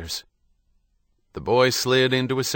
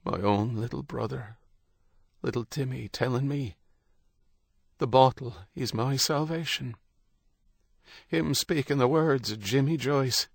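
tearful; 5 semitones higher than usual. A narrator's reading. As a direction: speak through held-back tears. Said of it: "Tears sprang from his eyes".

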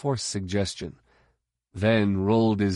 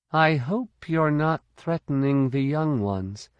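put-on young woman's voice; 6 semitones higher than usual. A narrator's reading. Jaina warns.

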